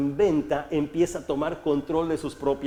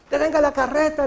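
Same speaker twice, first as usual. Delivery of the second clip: frantic maiden's cry; 10 semitones higher, 5 dB louder than usual. Retold or imitated. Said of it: "le decía la chica".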